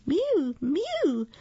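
high little mewing voices; mewing cats. A narrator's reading. Hungry cats complaining to the old man, high mewing. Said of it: "said the hundreds of cats".

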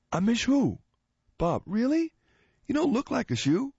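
high, fast, squeaky punchline; squeaky high joke voice. Acted. Bob answering the knock-knock joke, squeaky high and fast.

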